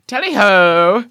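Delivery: high, loud echoed call; theatrical hail, loud and high; mock jolly holler. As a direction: sing out a hammed-up cheer. Co-host echoes the mock shout.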